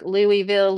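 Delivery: Louisville said as LOO-ee-vil; pronunciation variant demo. Shown as a demonstration. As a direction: say Louisville with an ee in the middle. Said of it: "there's literally signs that say".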